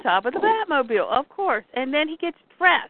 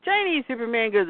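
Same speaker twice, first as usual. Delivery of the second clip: ordinary delivery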